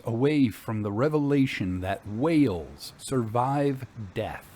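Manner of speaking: ordinary delivery, nothing marked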